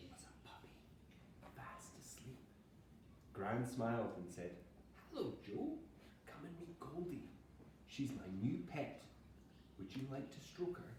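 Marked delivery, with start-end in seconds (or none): 0.1-2.4 soft unvoiced whisper; storybook hush. A narrator's reading. Reading gently about sleeping puppy. 5.1-10.9 lightly voiced kindly gran, higher; a hint of a gentle grandmother greeting; teacher giving Gran a soft lilt.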